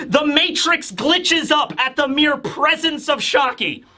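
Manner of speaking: ordinary talk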